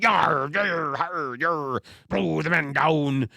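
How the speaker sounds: yarring like a cartoon pirate. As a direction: growl it out like an old sea dog. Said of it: "talk like a pirate, speak like".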